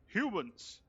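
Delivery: high, loud hail with a falling end; calling out. Acted. Elf hailing strangers from fifty feet away, loud call. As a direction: call it across a distance. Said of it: "call out".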